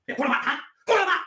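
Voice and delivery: high, loud taunting shout; aggressive challenge. Acted. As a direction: yell it like you're starting a street fight. Staged street-fight threat in training.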